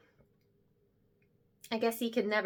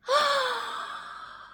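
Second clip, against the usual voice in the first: high, breathy gasp of shock; sharp gasp. Acted. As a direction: gasp like you can't believe it. Stunned by the famous name.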